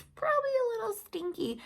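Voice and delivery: high, fast teasing stinky; playful yuck. Acted. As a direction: pull a face at the smell. Playful disgust for children.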